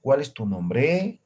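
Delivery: slow question, rising on "nombre"; question intonation. Shown as a demonstration. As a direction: stretch "nombre" and lift it at the end. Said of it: "hacemos una entonación".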